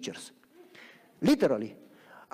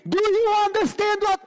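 high, loud exaggerated yell at a foreigner; mock American yelling; 14 semitones higher, 5 dB louder than usual. Preacher mimics Americans shouting at immigrants, high mock yell. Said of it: "they scream at you".

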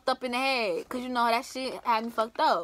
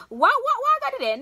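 mock protest; 14 semitones higher, much faster than usual. Mock protest at being offered weed.

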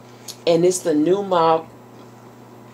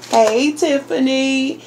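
higher, singy model greeting; chirpy greeting prompt; 6 semitones higher, 3 dB louder than usual. Shown as a demonstration. Parent prompting a child to greet Tiffany, chirpy. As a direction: say it brightly for a child to copy.

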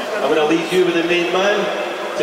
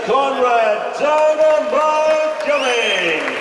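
much higher, slow, stretched fighter-name call; drawn-out ring name shout; 8 semitones higher, much slower than usual. Acted. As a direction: belt the names out to the arena. Announcing boxers into ring.

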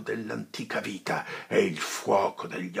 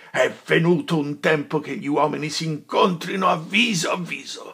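higher and louder, raging; furious tirade; 7 semitones higher, 8 dB louder than usual. Acted. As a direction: shout down the tyrant. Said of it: "cieco, affatto dalla collera".

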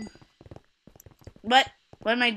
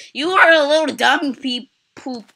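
loud, higher, playful name-calling; taunting; 6 semitones higher, 15 dB louder than usual. Pretend. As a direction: taunt them like a silly little kid. Taunting another player.

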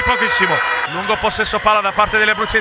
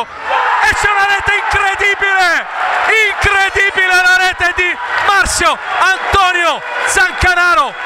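goal scream; 6 semitones higher than usual. Real, not acted. Goal call in futsal commentary.

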